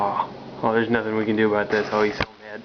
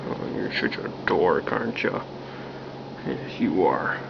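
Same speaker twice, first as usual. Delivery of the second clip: tender whisper; 6 semitones lower than usual. Real, not acted. Baby talk to cat.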